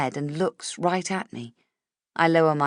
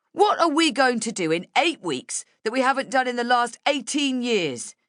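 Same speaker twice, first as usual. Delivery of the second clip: high, angry protest; bellowing; 8 semitones higher than usual. A narrator's reading. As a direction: bellow it at the boss. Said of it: "he bellows".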